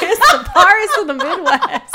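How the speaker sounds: cracking up while saying Paris of the Midwest; giggly loud Paris, said like Pairs; laughing. Real, not acted. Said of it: "Did you just say Paris?".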